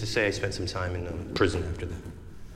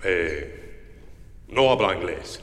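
put-on Spanish accent; 8 semitones lower, 5 dB quieter, slower than usual. Defendant voiced in Spanish accent.

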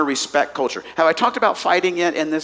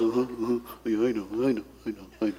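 bored husband; 6 semitones lower, 9 dB quieter, faster than usual. Retold or imitated. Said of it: "And the husband goes".

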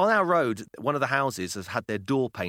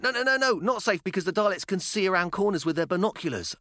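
put-on insistent child; 7 semitones higher than usual. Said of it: "daughter insists".

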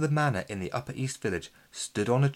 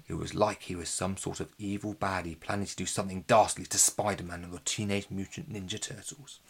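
ordinary delivery, nothing marked

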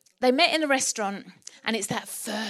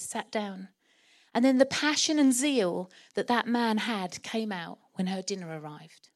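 normal talking voice

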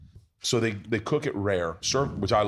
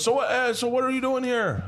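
much higher, friendly curious question; Conor McGregor; 13 semitones higher than usual. Retold or imitated. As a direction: play the sweet host, voice pitched way up. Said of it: "he's the sweetest guy".

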